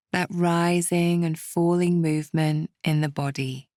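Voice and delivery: calm, unhurried meditation guide, with long pauses; guided meditation. Acted. Meditation teacher settling listeners to calm political worries, calm and measured. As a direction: ease the listener gently through each step.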